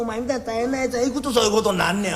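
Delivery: ordinary delivery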